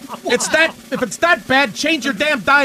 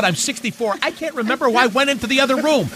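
normal talking voice

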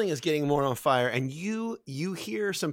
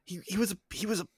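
stammering, higher-pitched excuses; flustered suspect; 4 semitones higher than usual. Acted. Cornered character explains a killing to accuser, flustered. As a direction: trip over words while denying involvement.

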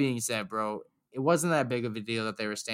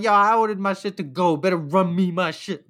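raised, higher pushy demand at the counter; loud demand; 5 semitones higher, 4 dB louder than usual. Retold or imitated. Customer demanding his order loudly at the bar. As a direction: play it like you're barking your order. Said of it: "I'm like".

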